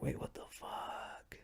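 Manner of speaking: hushed shocked whisper; stunned whispered what the fuck. Retold or imitated. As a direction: whisper it like you got caught off guard. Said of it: "and now you're like".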